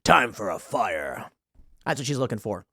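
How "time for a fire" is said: much lower, slow tough-guy voice; macho lumberjack. Retold or imitated. Lumberjack fantasy hero, low and slow, catching the wood. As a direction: play the rugged axe man, low and slow. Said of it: "and he goes".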